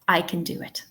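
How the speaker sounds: linked "I can do it" with "can" as "kun"; natural connected weak form of "can"; unstressed can. Shown as a demonstration. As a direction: slide past "can" to land on "do it". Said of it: "we're not stressing the word can".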